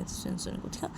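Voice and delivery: ordinary delivery, nothing marked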